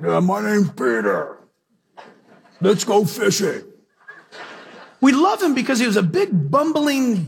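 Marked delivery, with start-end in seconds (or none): 0.0-3.6 lightly voiced dim big fisherman; big burly fisherman. Preacher imagining big burly Peter introducing himself, lightly voiced. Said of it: "I wonder what he had sounded like".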